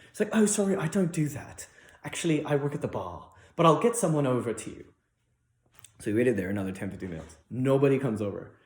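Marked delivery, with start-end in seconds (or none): 0.3-4.9 put-on British lady, higher pitch; English waitress. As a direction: imitate an apologetic British bar worker. English waitress voiced in story.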